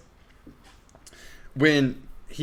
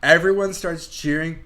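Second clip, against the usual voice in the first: ordinary delivery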